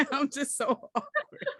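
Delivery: giggling through the words, slowed; laughing. Real, not acted. Laughing at herself to a friend, giggly. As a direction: laugh it out, a little embarrassed. Laughing at her own awkwardness.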